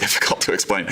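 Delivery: ordinary talk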